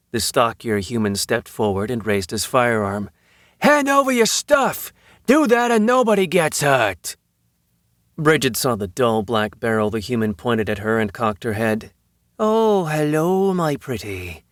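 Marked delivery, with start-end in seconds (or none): 3.6-7.2 high, raised-voice robber's threat; threatening demand. A narrator's reading. Gunman to cornered tourists with firearm raised, threatening. 12.4-14.5 drawn-out high greeting falling away; delighted cooing. A narrator's reading. Woman greeting the gun pointed at her, delighted cooing.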